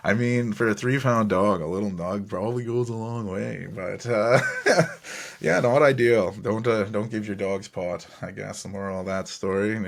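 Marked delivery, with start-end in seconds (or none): 4.4-5.4 short unvoiced chuckle; breathy laugh. Real, not acted. Laughing at own story.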